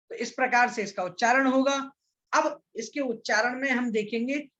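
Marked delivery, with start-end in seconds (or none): none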